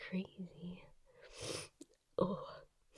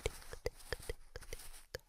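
soft rhythmic whispered trigger words; 9 dB quieter than usual. Shown as a demonstration. ASMR artist whispering trigger words to the listener, soft and rhythmic. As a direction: breathe each tinkle out gently.

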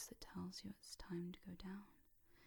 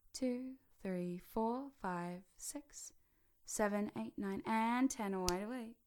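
louder, lifting count out of trance; waking count; 2 semitones higher, 4 dB louder, slower than usual. Shown as a demonstration. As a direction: count them awake, building to a firm finish. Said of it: "you'll be wide awake".

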